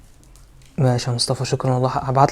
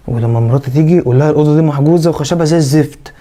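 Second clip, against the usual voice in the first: ordinary talk, nothing marked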